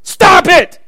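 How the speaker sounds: high, loud, sharp command; shouted command. Acted. Preacher shouting the prayer at the congregation, loud and high. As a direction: slam the words down.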